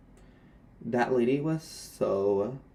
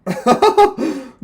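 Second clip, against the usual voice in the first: loud burst of laughter; 20 dB louder than usual. Real, not acted.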